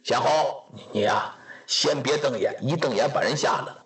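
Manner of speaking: a hint of a worried elderly lord warning the others; lightly voiced old lord. Lord Jiang Huanchu voiced by teller.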